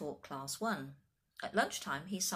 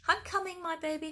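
high, loud motherly call; 12 semitones higher, 6 dB louder than usual. A narrator's reading. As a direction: call out reassuringly to a distant child. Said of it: "called George's mother".

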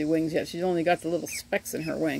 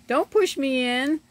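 higher, slow little duck voice; voicing a duckling; 7 semitones higher, 3 dB louder, slower than usual. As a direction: speak for the pushed duckling.

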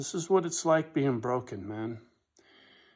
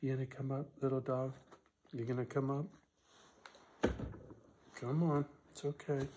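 soft coaxing to a little dog; 11 dB quieter than usual. Real, not acted. Owner softly coaxing his little dog up. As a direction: softly coax her to come up.

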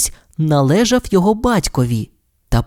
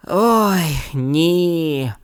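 groaning; 4 semitones higher than usual. Acted. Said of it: "простогнав він".

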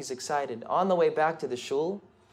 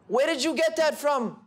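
high, frantic cry of shock; hysterical cry; 9 semitones higher than usual. A narrator's reading. Old woman sees the Magen David, crying out hysterically. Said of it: "she cries out in hysteria".

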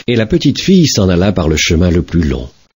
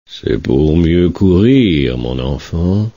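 sly wolf; slower than usual. Acted. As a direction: purr it slowly. Wolf answers slyly.

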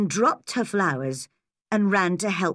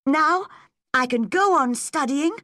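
put-on pleased girl's higher-pitched excitement; delighted; 8 semitones higher than usual. Anne thinking how pleased Matthew and Marilla will be, delighted. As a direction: lift the voice high with happy relief.

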